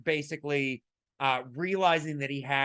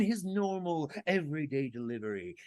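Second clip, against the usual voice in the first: lightly voiced practiced actor; a hint of the actor's trained diction; trained actor's delivery; 5 dB quieter than usual. Shown as a demonstration. Podcaster illustrating an actor's everyday delivery, lightly imitated.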